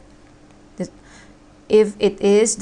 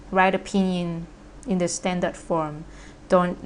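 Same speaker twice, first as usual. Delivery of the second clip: ordinary talk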